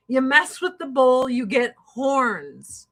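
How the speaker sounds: normal talking voice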